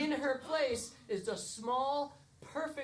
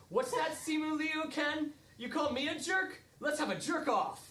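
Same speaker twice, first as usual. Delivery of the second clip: a touch higher, lightly taunting; cocky Ken challenge; 6 semitones higher than usual. Pretend. Ken challenges Simu Liu Ken in the dance circle, mock-tough. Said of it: "in the Ken circle".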